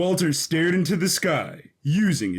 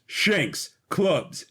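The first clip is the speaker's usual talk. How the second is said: usual speaking voice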